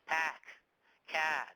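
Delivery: Boston accent with a wide open A in pack. Shown as a demonstration. Teacher giving a Boston example word, Boston accent. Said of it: "Boston tends to have more open A sounds".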